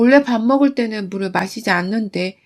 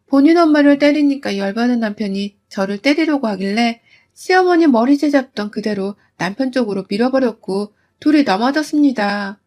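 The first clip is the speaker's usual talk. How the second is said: ordinary talk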